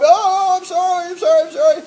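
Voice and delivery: loud, high imitation of a wailing little brother; hammed-up whining, pleading at the top of the voice; mock crying apology. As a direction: wail it like a kid being pounded. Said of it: "as he was crying and whining".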